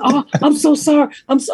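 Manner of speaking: high mimicked apology; imitating apologetic daughter. Daughter apologizing about the raspy voice remark, imitated high. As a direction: quote her apology in a high-pitched voice. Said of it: "She said".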